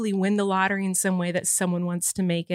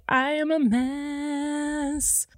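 long, drawn-out, higher-pitched admission; self-mocking lament; 5 semitones higher, much slower than usual. Real, not acted. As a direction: stretch the words out in exaggerated exasperation. Self-mocking admission after crying.

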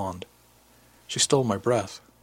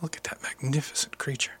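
hushed, softer whisper; 5 semitones higher, 8 dB quieter than usual. A narrator's reading. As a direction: whisper it in awe. He whispers to her at the mirror.

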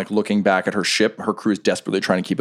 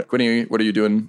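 usual speaking voice

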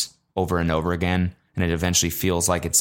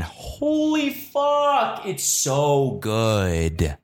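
drawn-out amazement; 5 semitones higher, much slower than usual. Real, not acted. Amazed at show.

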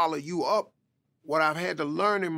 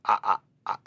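stuttering; 4 semitones lower, much faster than usual. Retold or imitated. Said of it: "I started to just stutter and stammer".

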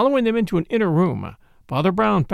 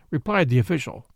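plain reading voice

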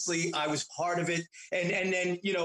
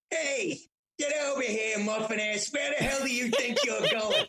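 imitating dad yelling; 6 semitones higher than usual. Son retelling how his dad yelled at the little brother. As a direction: bark it like an angry old-school father. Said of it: "my father's going".

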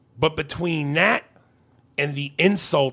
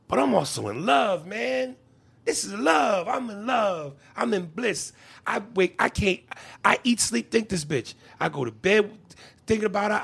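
lovestruck gushing; 6 semitones higher, 3 dB quieter than usual. Retold or imitated. Imitating lovestruck man.

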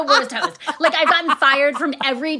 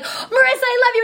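gushing fan; 11 semitones higher than usual. Retold or imitated. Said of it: "people would be like".

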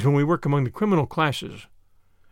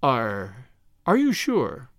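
timid eagerness; 4 semitones lower, much slower than usual. A narrator's reading. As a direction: ask it timidly but eagerly, stumbling on the first word. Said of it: "with the timid eagerness".

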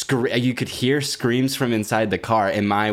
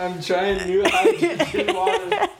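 laughing; 11 semitones higher than usual. Real, not acted. Laughing after choking on water.

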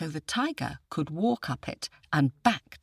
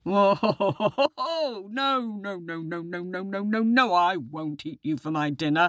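hammed-up chuckle into a run of no's; wheedling tiger; 4 semitones higher than usual. A narrator's reading. Tiger reassures the boy, hammed-up laughing denial. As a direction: start high and swooping, tumble down through the denials.

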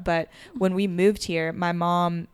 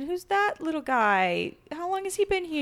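put-on cutesy baby voice; 10 semitones higher than usual. Talking about a cute cat.

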